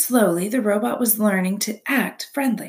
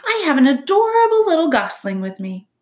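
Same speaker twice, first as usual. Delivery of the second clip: high, loud, cheery announcement; friendly booming; 9 semitones higher, 7 dB louder, faster than usual. A narrator's reading. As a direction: call brightly across a pond. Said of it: "friendly voice boomed over the water".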